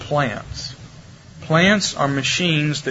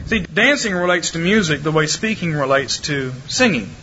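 usual speaking voice

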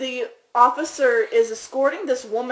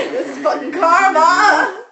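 slow, loud, higher jeering; laughing hammed-up gloat; mock taunt; 7 semitones higher, 7 dB louder, slower than usual. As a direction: drag it out gleefully, laughing. Imagined taunt at the woman.

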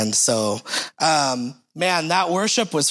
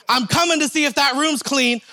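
wife calling down the hall; 7 semitones higher than usual. Retold or imitated. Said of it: "my wife's voice down the hall".

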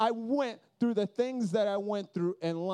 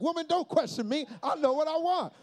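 macho bravado; 6 semitones higher than usual. Retold or imitated. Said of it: "I was like".

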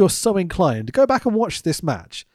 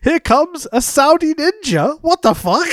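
laughing disbelief; 10 semitones higher, 4 dB louder than usual. Retold or imitated. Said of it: "I'm like".

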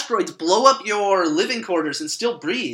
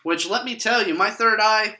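normal talking voice

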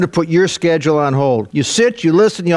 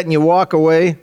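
normal talking voice

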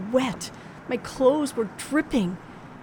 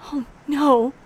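higher, louder gasp of dread; frightened cry; 4 semitones higher, 5 dB louder than usual. Acted. Woman reacting to her killer's threat, frightened. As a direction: recoil from what he's about to do.

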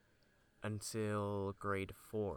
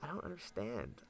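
put-on confused kid; 3 semitones higher, 6 dB quieter, slower than usual. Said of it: "And I'm like".

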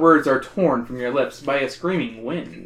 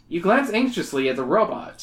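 ordinary delivery

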